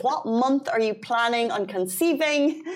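usual speaking voice

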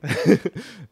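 short bright burst of laughter. Real, not acted. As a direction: laugh out at the question. Laughs at the cliché question.

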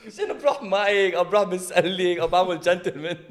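normal talking voice

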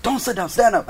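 imitating an angry parent; dad's stern order. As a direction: order your kid to his feet. Angry dad's order in front of principal.

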